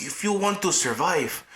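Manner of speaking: rough attempt at a German accent. Co-host imitating the doctor, German accent. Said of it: "she's got this German accent".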